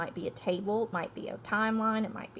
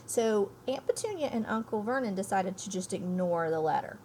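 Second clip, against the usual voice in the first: usual speaking voice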